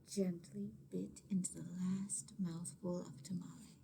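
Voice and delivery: soft suspenseful whisper. A narrator's reading. Reader building suspense for listening kids, whispered. Suspense in read-aloud story.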